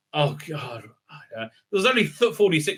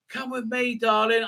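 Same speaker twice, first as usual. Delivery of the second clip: mock seductive lure; 7 semitones higher than usual. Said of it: "mocking the villain's lure".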